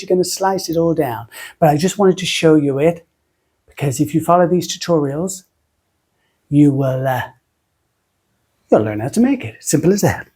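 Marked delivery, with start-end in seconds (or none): none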